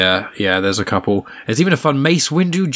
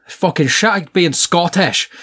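hammed-up Scottish accent, pitched higher; 6 semitones higher, slower than usual. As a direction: do a high mock Scottish voice. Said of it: "is written in phonetic Scottish".

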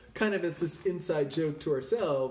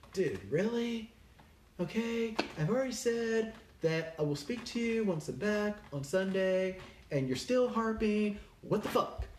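higher, mock-irritated snap at a friend; exasperated retort; 6 semitones higher than usual. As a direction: imitate being fed up with a nagging buddy. Annoyed reply to harping friend.

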